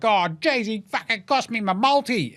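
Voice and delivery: high-pitched put-on whinge; imitating a griping colleague; mock whining complaint. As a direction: whine like an aggrieved bettor. Mimics stats man's complaint.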